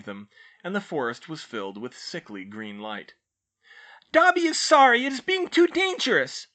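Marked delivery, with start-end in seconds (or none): 4.1-6.5 very high, loud squeaking elf voice; squeaky elf. A narrator's reading. House elf squeaking to Hermione from her shoulders, high and loud.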